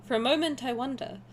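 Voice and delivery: ordinary talk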